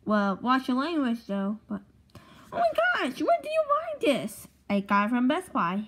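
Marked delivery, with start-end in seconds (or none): 2.5-4.5 much higher, thrilled and eager; amazed. Acted. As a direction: gush over the shiny toy. Amazed by the gadget.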